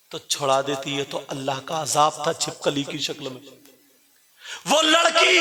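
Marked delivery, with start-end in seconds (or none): 4.7-5.4 preacher's wail. Acted. Sermon climax, drawn-out wail.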